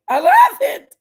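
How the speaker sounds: very high, loud excited shout; excited squeal. Real, not acted. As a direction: shriek it with delight. Gushing about Dolly's call.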